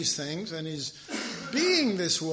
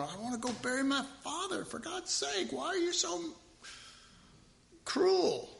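high, pleading mock whine; whiny disciple; 9 semitones higher, 5 dB quieter than usual. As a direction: whine it like a hurt follower. Disciple whining at Jesus.

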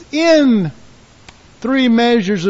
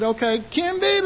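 retold rallying call; 7 semitones higher, 3 dB quieter than usual. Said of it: "yell out real loud".

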